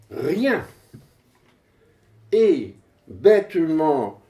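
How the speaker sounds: measured, emphatic recitation with pauses; poem declamation. Acted. Poet reads verse about computers to an audience, declaimed.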